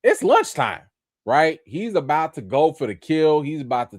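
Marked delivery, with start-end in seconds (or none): 0.0-0.8 creepy clown calling lunchtime; menacing clown. Retold or imitated. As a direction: sing it out like a clown eyeing prey. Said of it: "he's like".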